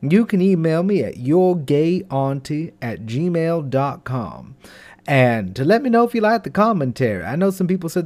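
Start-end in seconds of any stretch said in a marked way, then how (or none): none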